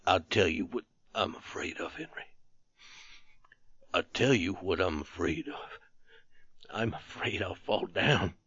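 low, hushed, halting confession; shaken whisper. A narrator's reading. Terrified soldier fearing the others will hear, hushed. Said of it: "speak in a shaken whisper".

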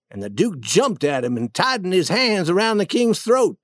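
ordinary delivery

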